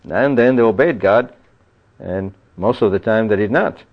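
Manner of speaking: ordinary talk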